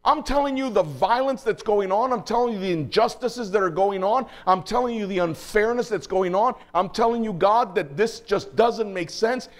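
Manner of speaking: high-pitched, insistent preacher's plea; crying out to God. Retold or imitated. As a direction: plead with God as the prophet, pressing each complaint. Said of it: "He's crying out to God".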